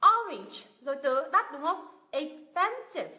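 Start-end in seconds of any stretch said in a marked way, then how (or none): 0.1-0.6 orange with a loud, high O; strong falling stress on orange's first beat; stress first syllable. Shown as a demonstration. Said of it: "cái âm o này mình nhấn này". 2.1-3.1 expensive with PEN high and strong; slow 'ex-PEN-sive', middle beat punched; stress second syllable. Shown as a demonstration. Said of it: "cái âm thứ hai này mình nhấn này".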